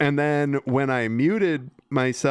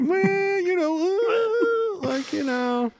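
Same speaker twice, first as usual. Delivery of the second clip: silly falsetto; slower than usual. Acted. S0 joking falsetto aside.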